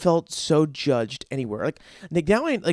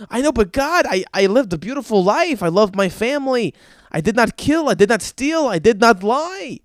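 higher, insistent plea in rising steps; mock pleading; 4 semitones higher, 3 dB louder than usual. Ira defending his life to God, mock-indignant pleading. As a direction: plead your case, hitting each denial.

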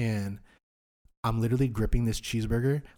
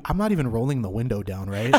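ordinary talk, nothing marked

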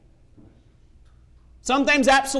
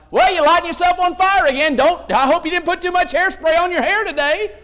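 teasing joke; 7 semitones higher than usual. Retold or imitated. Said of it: "I'm like".

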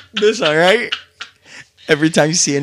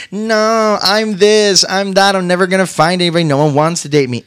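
imitating her, high and whiny; mock her moaning about dating; mocking her self-pity; 6 semitones higher than usual. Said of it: "You're like".

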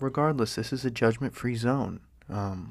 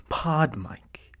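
whispering; 4 semitones higher, 3 dB louder than usual. Shown as a demonstration. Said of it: "mic showcase whisper".

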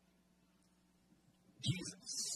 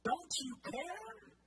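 Martha complaining. Retold or imitated. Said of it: "And she says".

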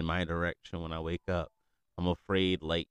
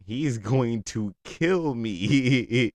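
loud, high, very drawn out; laughing mock panic; 5 semitones higher, 9 dB louder, much slower than usual. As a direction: wail it slowly through laughter. Exaggerated fear while laughing.

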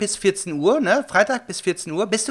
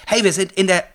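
usual speaking voice

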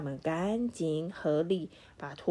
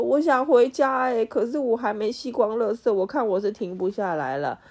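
loud, higher desperate shout; 5 semitones higher, 7 dB louder than usual. Acted. Runaway Toto shouting that he wants to go home, loud desperate yell. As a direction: yell it out in despair. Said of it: "他大叫说".

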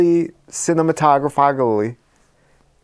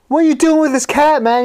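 panicked question; 13 semitones higher, 5 dB louder, faster than usual. Retold or imitated. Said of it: "And he goes".

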